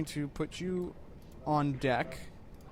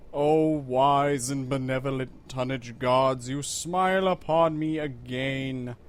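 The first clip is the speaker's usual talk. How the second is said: slow, loud, hammed-up invocation; mock prayer; 5 dB louder, slower than usual. Thanking the tonnage gods for targets, mock solemn. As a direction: pray grandly to the tonnage gods, tongue in cheek.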